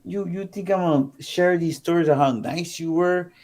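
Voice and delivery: high, scornful opening easing into indignant rant; retold anger. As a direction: act out her sarcastic accusation. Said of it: "when I tell you she was pissed".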